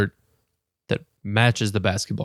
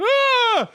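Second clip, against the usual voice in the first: sudden high yelp; imitated girl's scream. Retelling the girl startled by the dog, high mock yelp. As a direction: shriek like a startled kid. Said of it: "she gets freaked out and does the".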